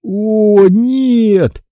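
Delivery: high, drawn-out lament; wailing. A narrator's reading. Boy loses sight of floating dog, wailing. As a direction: wail it high, at moderate volume. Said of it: "запричитал я".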